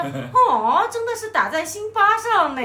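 高调大声、夸张地念出俏皮话; 像舞台上抖包袱一样拔高嗓门; 搞笑台词. Retold or imitated. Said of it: "这是一句搞笑白". (边笑边夸张地念搞笑台词)

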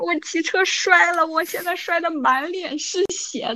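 high, slow tearful wail; imitated crying. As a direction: retell it as a drawn-out sobby complaint. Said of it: "整个过程我在哭". (模仿拖着哭腔诉苦)

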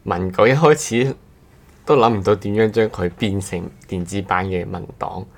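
ordinary talk

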